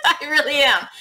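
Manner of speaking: sheepish high laugh; giggling admission. Real, not acted. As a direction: laugh it off, caught out. Admits she keeps buying kits.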